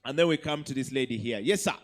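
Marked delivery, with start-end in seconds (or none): none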